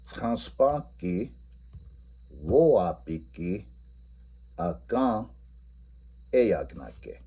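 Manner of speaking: each Lakota word paced slowly; modelling the students' Lakota answer; slow model answer. Shown as a demonstration. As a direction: spell out the reply word by word. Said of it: "their response should be".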